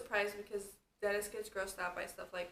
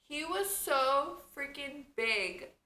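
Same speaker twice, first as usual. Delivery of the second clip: amazed; 6 semitones higher, 6 dB louder, slower than usual. Real, not acted. Amazed at baby's size.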